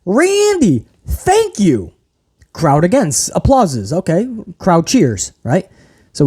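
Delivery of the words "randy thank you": high, mock grateful whine; mock Riddle. Imitating Riddle thanking Randy, mock gushing. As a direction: gush with fake gratitude. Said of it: "Then he says".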